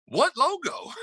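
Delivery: very high, imitated baffled retort; mock incredulity. Retelling the other coach's retort about the logo, mock incredulity. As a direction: squeal it in put-on disbelief.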